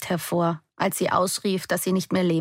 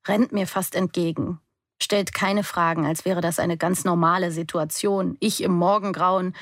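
plain reading voice

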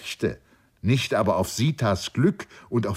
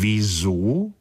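puzzled repeat. A narrator's reading. The slender friend echoes the question, puzzled. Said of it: "wiederholte der feingliedrige Freund".

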